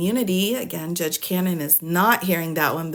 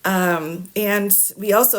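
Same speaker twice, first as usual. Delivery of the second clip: normal talking voice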